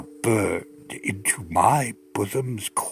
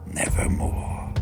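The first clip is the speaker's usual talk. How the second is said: whispered reply; 5 dB quieter than usual. Acted. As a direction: let the bird breathe the word. The raven's eerie reply.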